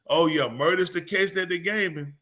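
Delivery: usual speaking voice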